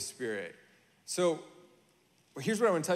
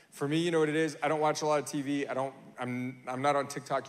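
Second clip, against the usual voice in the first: usual speaking voice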